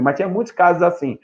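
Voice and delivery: ordinary talk, nothing marked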